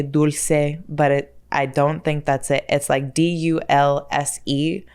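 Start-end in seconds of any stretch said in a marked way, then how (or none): 0.0-0.8 dulce said DOOL-say; Spanish pronunciation. Shown as a demonstration. Spanish in her soul wants it, dulce as DOOL-say. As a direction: say dulce the Spanish way, two syllables. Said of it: "The Spanish in my soul".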